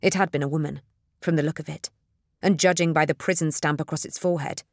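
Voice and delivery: ordinary delivery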